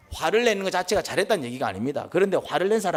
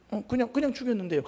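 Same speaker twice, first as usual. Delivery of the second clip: higher, softer, casual offhand reply; a lightly voiced killer shrugging off the question; psychopath's answer; 6 semitones higher, 4 dB quieter, faster than usual. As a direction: say it lightly, as if killing were nothing.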